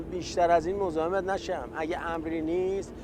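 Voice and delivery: normal talking voice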